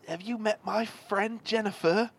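high-pitched mock-British introduction; put-on British accent. Student introduces friend to Ron, put-on British accent. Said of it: "And we'll be British".

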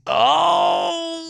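high loud scream of shock; shocked shriek. Real, not acted. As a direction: let out a sudden shriek. Shrieking at the kiss.

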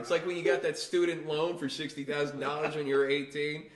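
loud, hammed-up berating; loud comic telling-off, punching the insult; mock scolding. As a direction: chew him out as a mock judge, loud throughout. Acts judge scolding the divorced man.

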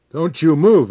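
loud, high command; sharp shouted order; shouted warning. A narrator's reading. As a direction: shout it to freeze him on the spot. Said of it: "I shouted".

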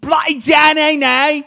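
shouted high mock English accent, slow; over-the-top fake Cockney shout; bad English accent. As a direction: yell it like a cartoon Brit. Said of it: "I try to do an English accent".

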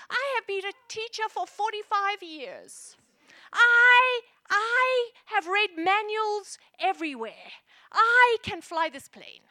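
put-on loud, slowed, earnest old lady insisting; hammed-up old teacher boasting she can fly; sweet old lady. Old lady passenger offers to fly the plane, loud earnest boast. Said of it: "sweet little old lady".

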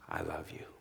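barely audible. Acted. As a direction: let it fall to almost nothing. God's love, final hush.

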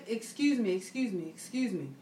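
soft, polite excuse-me said low and quiet; repeated soft excuse me while squeezing through a crowd. Retold or imitated. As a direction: ease through a crowd politely, almost under your breath.

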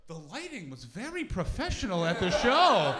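politely damning praise, building to loud; posh coworkers. Acted. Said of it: "this very posh fitness company".

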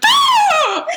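a loud, high yelped hiccup in the middle of a yawn. Retold or imitated. Imitating the huge office hiccup with a loud high yelp. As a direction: let out a sudden squeaky hiccup-yelp. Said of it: "so it's like".

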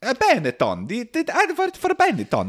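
squeaky-high comic Italian; Italian accent. Acted. Italian family boasting about Benetton adverts in a high comic Italian accent. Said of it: "Italian accent".

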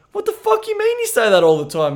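high disbelieving shriek of surprise; incredulous squeal. Retold or imitated. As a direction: squeak out your shock at him. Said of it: "I was like".

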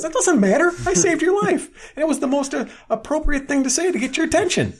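high, loud squeaky character voice with laughter breaking through; imitating Frank. Frank voiced in retold episode.

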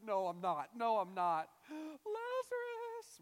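loud protest tipping into high wail; gasp, then a drawn-out mock sobbing cry; acted emotional breakdown. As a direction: lose composure, building to a stretched-out wail. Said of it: "has these emotions still going through him".

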